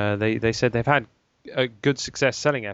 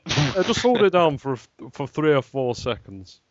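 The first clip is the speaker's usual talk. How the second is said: put-on Barnsley-Russian accent; 4 dB louder than usual. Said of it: "Barnsley meets Moscow".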